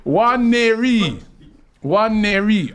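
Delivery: "One Neri" high, loud, slow; drawn-out name. Shown as a demonstration. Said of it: "So pronounce that".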